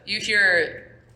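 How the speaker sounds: normal talking voice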